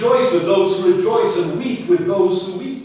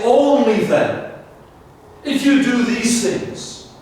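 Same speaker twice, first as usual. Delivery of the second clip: ONLY stretched out slow and stressed; emphatic only then; 3 semitones higher, slower than usual. Acted. Preacher giving the Hebrew's force, slow and emphatic. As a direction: lean hard on Only then. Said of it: "You could almost say".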